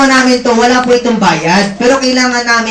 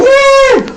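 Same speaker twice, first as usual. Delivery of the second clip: very high, loud cheer; cheering woo; 4 dB louder than usual. Retold or imitated. Speaker showing how invitees cheer, high loud whoop. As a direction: whoop like an excited crowd. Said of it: "sisigaw kayo".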